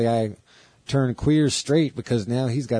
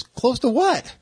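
much higher mock outrage; Chris's indignant squeak; slower than usual. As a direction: squeak it out like you're offended. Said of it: "you know how Chris is".

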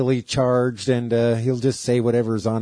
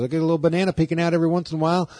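higher, fast mock Andy voice; imitating Andy; 7 semitones higher, much faster than usual. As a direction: rattle it off quick and higher. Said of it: "He goes".

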